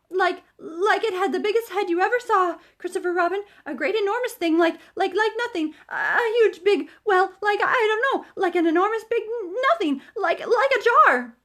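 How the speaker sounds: high, loud flustered stammer; frightened babble. Acted. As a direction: trip over the words in terror. Piglet describes the heffalump.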